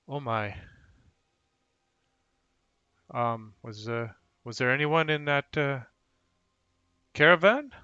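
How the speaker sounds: hesitant question rising high and loud at the end; changed voice. Acted. Man asking orcs about the wagon, voice slightly changed. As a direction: ask haltingly, lifting sharply on the last word. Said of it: "his voice changes a little bit".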